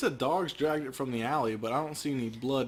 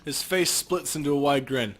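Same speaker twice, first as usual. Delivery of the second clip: ordinary talk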